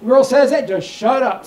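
ordinary delivery

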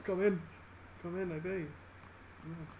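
quiet taunt. Real, not acted. Taunting quietly while hiding.

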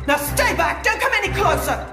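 high voice rising in panic; frightened warning. Acted. Brother warns off feared killer.

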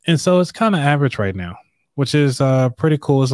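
ordinary talk

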